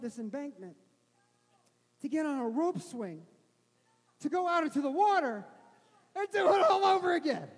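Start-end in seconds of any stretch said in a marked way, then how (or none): 2.0-7.5 from normal to much louder and higher, building to a shout; building preacher's climax. Real, not acted. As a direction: build to a roar on the punchline. Climax of a sermon story.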